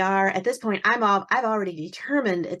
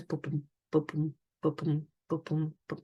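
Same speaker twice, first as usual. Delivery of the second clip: soft paired heartbeat thumps; small rhythmic "buh-boom" of a heartbeat, fading; imitated fetal heartbeat; 5 dB quieter than usual. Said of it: "That's the heartbeat".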